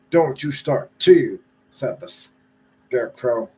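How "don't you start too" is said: loud rising snap; sharp scolding. Acted. Scarecrow scolding the sleepy Lion, loud and sharp. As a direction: scold him to stay awake.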